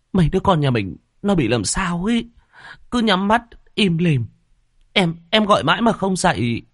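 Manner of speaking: high, crying voice, words tumbling; tearful pleading. Acted. Wife tells husband the children won't wake: crying, high and distraught. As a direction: plead through tears, distraught. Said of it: "Thị Lam lức lờ".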